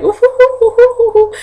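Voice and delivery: a very high, hooting imitation of his laugh; imitated laughing. The dorm parent laughing at her joke, high hooting. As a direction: hoot it out high like a giggling man. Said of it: "He was just laughing".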